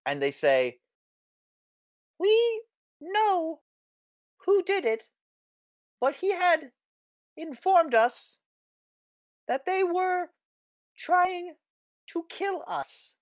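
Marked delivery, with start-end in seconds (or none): 2.2-13.1 very high squeaky voice; squeaky creature voice. Acted. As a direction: speak through a tiny squeaker. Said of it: "their little squeaker that they used to speak through".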